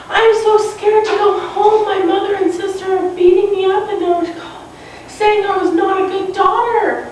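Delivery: high-pitched pretend crying voice; mock tearful. Scared girl telling an old lady her troubles, mock tearful. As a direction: plead like a scared girl, lightly acted. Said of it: "she started crying and crying 'cause she was scared".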